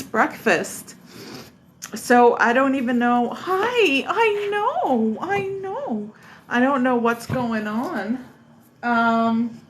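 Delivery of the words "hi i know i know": pet talk. Real, not acted. Greeting dog.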